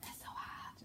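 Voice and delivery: hushed, breathy complaint; strained lament. Acted. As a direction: squeeze it out under your breath. Line demonstrated with a grabbing action.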